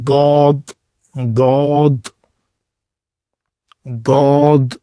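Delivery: ضاد heavy, the left tongue edge to the side teeth; Arabic dad demo. Shown as a demonstration. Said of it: "کناروں سے جا کے ملے گا".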